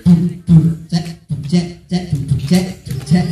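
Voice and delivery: loud low vocal drum beat; beatboxing drum. Acted. Storyteller making a drum beat for the kids, loud vocal percussion. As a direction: thump out a drum rhythm.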